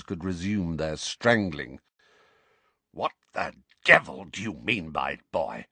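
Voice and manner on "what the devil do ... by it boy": a hint of croaky, trembling rage; croaky trembling fury. A narrator's reading. Uncle barely containing his fury at the boy. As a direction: hold the fury down in your throat. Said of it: "in a croaky voice that trembled with fury".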